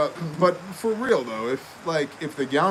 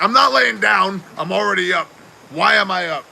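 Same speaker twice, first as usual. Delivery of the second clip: loud, higher complaint; exasperated protest; 5 semitones higher, 8 dB louder than usual. Retold or imitated. Patient arguing with the nurses, loud exasperated protest. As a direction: protest in fed-up disbelief.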